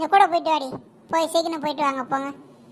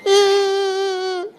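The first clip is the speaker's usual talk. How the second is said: long, high whimpering hum; mock crying whine; 4 semitones higher, 4 dB louder than usual. Girl crying on the bus, long high mock whine. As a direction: whimper through closed lips, drawn out. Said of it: "யார் இது அழுதுகிட்டு இருக்கிறது?".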